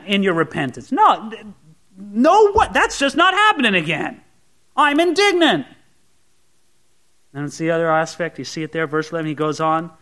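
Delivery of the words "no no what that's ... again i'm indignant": mock indignant protest. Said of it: "acting indignant believer".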